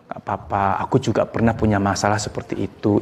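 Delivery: low, soft, steady comfort; soothing. Shown as a demonstration. Friend comforting someone depressed after listening, soothing. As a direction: settle them gently, voice dropped low. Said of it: "Itu nenangin".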